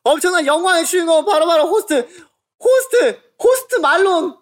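high, hyped announcer build-up; announcer intro. Acted. Producer introducing the host, high hyped announcer. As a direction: build the hype like a game show host.

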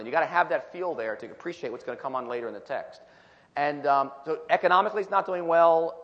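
ordinary delivery

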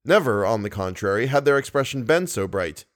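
normal talking voice

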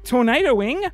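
loud, bright anchor delivery; news read. Pretend. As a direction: sell each headline like a radio newsreader. Fake news bulletin read.

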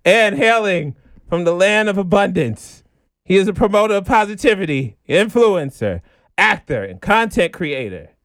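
upbeat host introduction; announcer intro. Acted. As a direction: present the guest like a show host.

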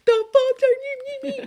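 high, loud mock outburst; hammed-up high-pitched freak-out; wiling out. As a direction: act out losing it at a cop, drawn out. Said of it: "on Cops that starts wiling out".

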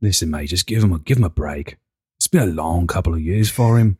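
low-pitched, matey accented impression; low, measured "listen, mate" voice; Johnny Bucks. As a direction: drop the pitch and reason with him like a mate. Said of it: "Johnny Bucks, light him up".

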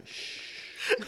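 sharp shh at a heckler; shushing. Shown as a demonstration. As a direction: hush them with one shh. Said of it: "you just go".

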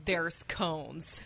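imitating deadpan clerk. Retold clerk repeats herself flatly.

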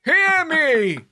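high, slow, laughing proclamation; prophet voice. Pretend.

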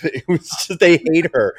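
ordinary talk

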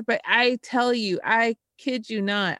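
ordinary delivery

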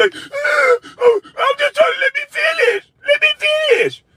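high squeaky stuttering yelp, far above his own voice; mock stammering panic. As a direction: squeal it out like a flustered man tripping over his words.

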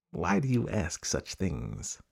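soft, slow crafty question; luring crocodile. Acted. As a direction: ask quietly and slowly, drawing the prey in. Crocodile lures the elephant's child.

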